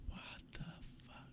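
stunned, hushed whisper. Real, not acted. Reacting to the friend's creepy story in a whisper. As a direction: breathe it out in shock.

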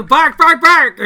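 loud barking like a dog. Acted. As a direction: bark it out loud and high. Barking at another player.

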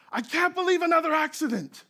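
high, loud imitating scream; retold scream. Teen driver screams at the crash scene in high mock panic. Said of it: "he just starts screaming".

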